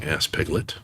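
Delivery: ordinary delivery, nothing marked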